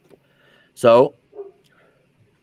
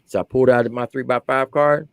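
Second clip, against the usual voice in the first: normal talking voice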